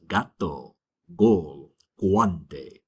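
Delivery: gato, gol, guante with the G of "leg"; hard G sound. Shown as a demonstration. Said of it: "what we call a hard sound".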